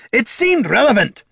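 high, loud defensive retort; shot back. A narrator's reading. Dwarf retorting to the mage, shot back. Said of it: "Gorm shot back".

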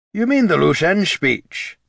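put-on a fussy old gentleman asking.

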